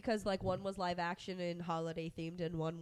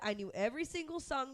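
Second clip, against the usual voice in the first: normal talking voice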